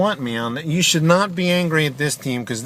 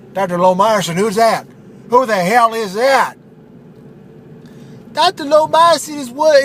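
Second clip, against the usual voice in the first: high, loud goofy bro voice; Phil the ad pitchman; 8 semitones higher, 4 dB louder than usual. Acted. Radio ad buddy Phil calling to Steve, high loud bro voice. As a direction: belt it like a cheesy radio ad buddy.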